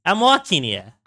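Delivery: put-on light New York mafioso accent. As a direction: toss off the New York tough-guy line. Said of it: "I can go with a classic".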